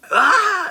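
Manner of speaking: sudden loud, high-pitched scream; fake yell of pain. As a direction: yell as if something grabbed your hand. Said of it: "I start yelling like something's got a hold".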